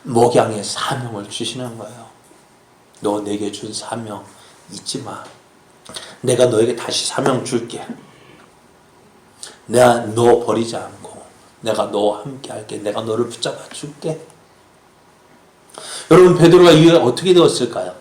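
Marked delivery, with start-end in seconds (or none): 3.0-14.2 gentle acted comfort; tender reassurance. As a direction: reassure a friend in a hushed, lowered voice. Voices Jesus reassuring Peter.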